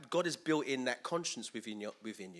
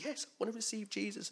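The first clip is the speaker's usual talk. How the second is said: mock eager convert; 9 semitones higher than usual.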